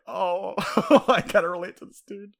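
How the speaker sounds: high, loud, laughing through the words; laughing sympathy. Retold or imitated. Laughing to a co-host about pitying the goon. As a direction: chuckle as you own up to feeling for him.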